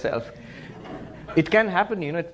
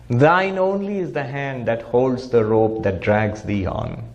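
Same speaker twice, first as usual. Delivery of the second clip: slow, lower recited verse; poem recitation; 4 semitones lower than usual. Acted. Swami quoting Vivekananda's poem, slow and lower. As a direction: intone the poem line slowly.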